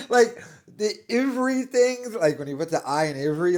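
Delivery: ordinary talk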